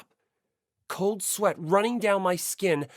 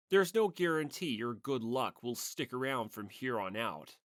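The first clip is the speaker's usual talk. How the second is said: lower, softer, measured know-it-all; lower, detached voice, fading to the end; cool lecturing classmate; 6 semitones lower, 7 dB quieter than usual. Acted. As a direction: lay out the cold logic quietly.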